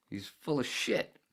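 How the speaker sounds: laughing dismissal. Real, not acted.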